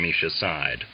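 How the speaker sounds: ordinary delivery, nothing marked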